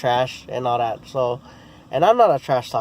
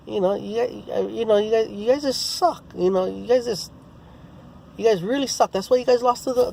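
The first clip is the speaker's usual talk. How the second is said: high, mocking trash talk; taunting in a higher voice; 9 semitones higher than usual. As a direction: rub his team's losses in his face. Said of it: "so I start getting in on him".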